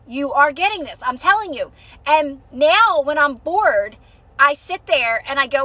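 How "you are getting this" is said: ordinary talk, nothing marked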